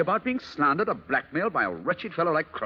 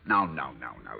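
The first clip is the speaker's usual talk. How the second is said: low, soft hushing; calm her like a frightened child; soothing; 4 dB quieter than usual. Acted. Husband soothing his anxious wife, low and soft.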